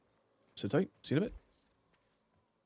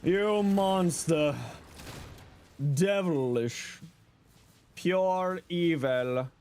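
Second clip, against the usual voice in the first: slow, drawn-out mock horror; hammed-up villain accusation; dramatic mock accusation; 3 semitones higher, much slower than usual. Mock accusation after joke.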